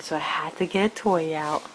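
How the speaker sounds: usual speaking voice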